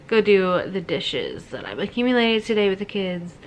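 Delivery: normal talking voice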